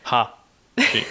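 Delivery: Ha She split in two, weight on Ha; stress on first syllable. Shown as a demonstration. Learner sounding out chopsticks, stress on Ha before She. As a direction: hit Ha, then a separate She. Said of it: "if you put the emphasis on ha".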